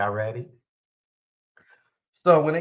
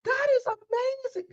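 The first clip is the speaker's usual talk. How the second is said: squeaky, much higher gushing stepmom; stepmom's excited voice; 3 dB quieter than usual. Retold or imitated. Teasing his stepmom, he mimics her excited high gush. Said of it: "'Cause that's how she talk".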